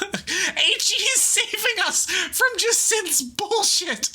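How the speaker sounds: high laughing delighted shout; excited cheer. Real, not acted. Streamer greeting character with a laughing high cheer. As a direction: greet a friend with glee.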